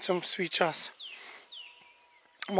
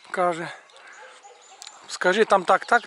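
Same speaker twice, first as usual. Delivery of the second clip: ordinary delivery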